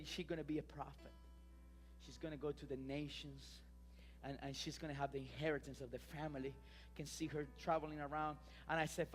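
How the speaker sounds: low, soft prophetic declaration; subdued, softly spoken sermon; hushed preaching. Real, not acted. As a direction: drop low and quiet to the congregation. Quiet declaration over children.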